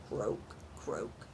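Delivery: low, soft croaking frog. Acted. Frog sound in story.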